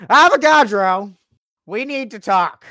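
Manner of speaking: loud confrontation. Acted. Character bursts in confronting.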